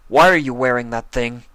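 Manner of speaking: sharp, loud demand. Acted. Spike confronting Rarity, sharp and loud. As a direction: snap the question at her. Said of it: "He asked sharply".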